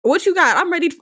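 high, loud challenge; mock squaring up. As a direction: hype it like you're ready to fight. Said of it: "I was like".

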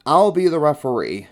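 a hint of an official calling out; announcing referee. A narrator's reading. Man volunteers to run the race, announcing loudly.

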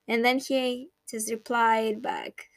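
ordinary talk

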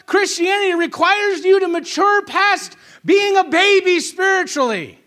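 high, forceful pulpit delivery; impassioned preaching. Real, not acted.

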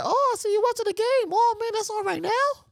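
chirpy imitation of her; imitating his girlfriend. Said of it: "His girl was like".

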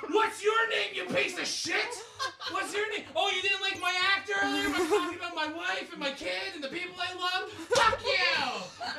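high, loud heckling the room; comic screaming at the crowd while naked; yelling at crowd. Retold or imitated. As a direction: shout down a heckler. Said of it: "I started being like".

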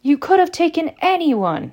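high, loud incredulous protest; disbelief. A narrator's reading. Ron questioning his friend's choice of party date, disbelief. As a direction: voice leaps up in sheer disbelief. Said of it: "said Ron in disbelief".

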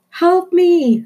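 very high, loud cry for help; panicked scream. Acted. Rita screams for help as the cook brings a knife, very high and loud. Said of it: "screamed Rita".

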